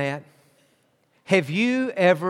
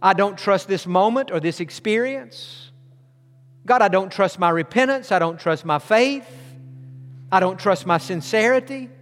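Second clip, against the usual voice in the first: guided prayer; 3 semitones higher than usual. Acted. Models a prayer of trust.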